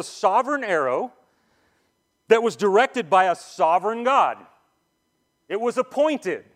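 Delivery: high, loud, emphatic sermon delivery; preaching at full force. Acted. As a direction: drive the point home from the pulpit.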